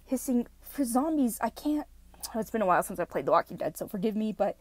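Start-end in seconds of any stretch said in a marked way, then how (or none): none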